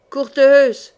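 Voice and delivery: loud summons with a falling end; calling cry. A narrator's reading. Voice from the night calls Courteheuse, loud cry. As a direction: call the name out forcefully. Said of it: "cria la voix".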